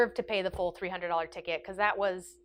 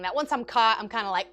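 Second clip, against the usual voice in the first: ordinary talk, nothing marked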